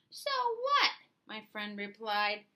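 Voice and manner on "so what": much higher, breezy so what; dismissive shrug. Acted. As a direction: brush the complaint aside. Friend shrugs off complaint.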